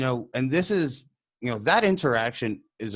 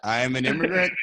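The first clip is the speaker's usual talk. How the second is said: chuckling through the reply; amused, laughing it out; laughing at the absurdity; 8 semitones higher, 4 dB louder than usual. Retold or imitated. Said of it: "And I'm".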